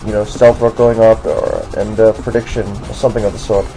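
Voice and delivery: put-on generic American doing a British accent throughout. Commentating a Pokemon battle for viewers in a put-on British accent. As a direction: keep a mock British accent the whole way through. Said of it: "I would do this video in a British accent".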